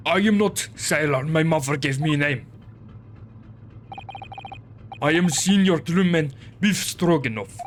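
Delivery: gruff Russian sailor, proud; broken English with a heavy Russian accent. Acted.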